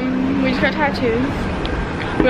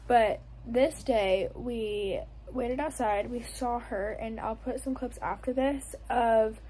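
quiet voiceover; 14 dB quieter than usual. Real, not acted.

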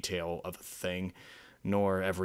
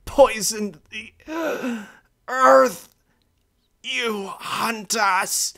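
put-on high, slow, broken old voice, loud and cold; old pronghorn condemning the soldiers, cold and grieving; voice of a glacier; 11 dB louder, much slower than usual. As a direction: accuse them word by word, near tears. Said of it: "spoke in the voice of a glacier".

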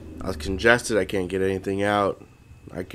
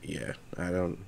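whisper; 10 dB quieter than usual. Real, not acted. Trails off under his breath.

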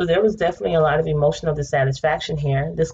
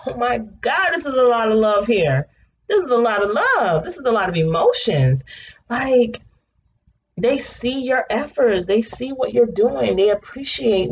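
excited; 7 semitones higher than usual. Real, not acted. Gushing over the love reading.

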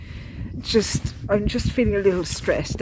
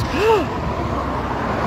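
surprised gasp; 12 semitones higher than usual. Retold or imitated. Man amazed by pilgrim.

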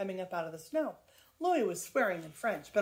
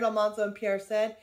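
ordinary delivery, nothing marked

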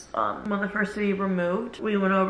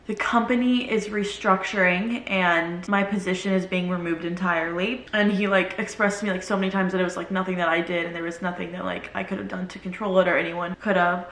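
ordinary delivery

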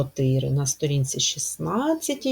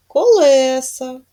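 колеса, stressed on the last syllable, said higher and louder; 7 semitones higher, 9 dB louder than usual. Shown as a demonstration. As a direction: stretch out the final -са. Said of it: "у нас змінився наголос". (наголос на останньому складі: колеСА)

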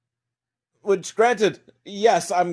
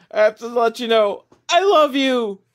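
high, loud imitation of an earnest declaration; imitating an overwrought lovesick outburst; mock love confession; 9 semitones higher, 5 dB louder than usual. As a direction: blurt out a hammed-up confession of love. Acted love confession from movie.

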